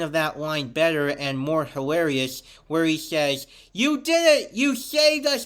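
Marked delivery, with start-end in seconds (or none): 3.7-5.5 high, loud triumphant exclamation, acted; excited cheer. Villain thanking Perry the Platypus, high excited cheer. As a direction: cry out like a rescued cartoon villain. Acting out final version's cheer.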